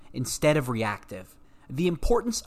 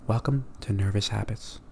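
calm host welcome; 3 semitones lower, 3 dB quieter than usual. Shown as a demonstration. Host imagining a calm intro, quiet and unhurried. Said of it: "I spoke like that".

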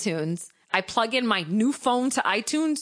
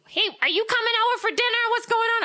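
much higher, fast, chirpy friend voice; high girly voice; faster than usual. Retold or imitated. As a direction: squeak it out like an eager friend. Friend texting about dinner.

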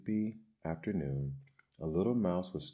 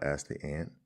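ordinary delivery

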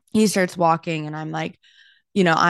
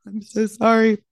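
higher, louder tearful apology; mock crying; 5 semitones higher, 5 dB louder than usual. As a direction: blubber an apology, imitating your crying self. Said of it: "he sees that I'm crying".

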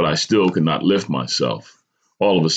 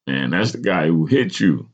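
ordinary delivery, nothing marked

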